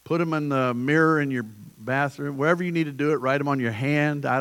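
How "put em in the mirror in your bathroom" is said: ordinary talk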